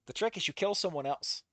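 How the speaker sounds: usual speaking voice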